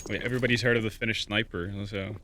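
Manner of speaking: ordinary talk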